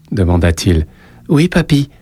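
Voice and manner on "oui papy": put-on higher child's voice, shy; little boy. A narrator's reading. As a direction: voice a small boy giving his name. Boy answers grandfather. (petit garçon timide, voix aiguë)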